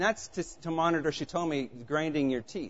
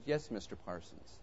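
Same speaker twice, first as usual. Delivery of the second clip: quiet reply over speaker; 5 semitones lower, 13 dB quieter than usual. Retold or imitated. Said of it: "She goes".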